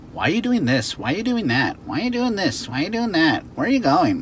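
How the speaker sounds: high, whiny nagging questions; pestering questions. Shown as a demonstration. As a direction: mimic a pest asking nonstop. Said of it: "keeps asking you questions over and over".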